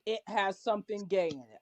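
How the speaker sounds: usual speaking voice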